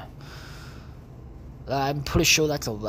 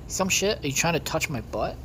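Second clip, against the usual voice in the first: ordinary talk, nothing marked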